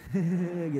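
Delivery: soft, sour fake chuckle; sour forced laugh. Shown as a demonstration. Envious friend laughing along at a new car, sour forced laugh. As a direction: force out a reluctant little laugh. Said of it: "kecawanya agak kecut gitu".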